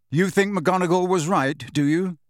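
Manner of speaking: loud, high angry challenge. A narrator's reading. Harry challenges Hermione behind her newspaper, angry. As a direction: throw the question at her angrily. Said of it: "said Harry angrily".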